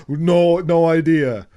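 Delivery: loud, imitated playing dumb; mock clueless denial. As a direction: deny it loudly, hamming up the ignorance. Acted denial of knowing anything.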